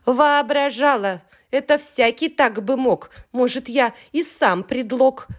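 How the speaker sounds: mock-indignant yell; indignant shout. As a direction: call her out as a show-off. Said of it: "В кричит".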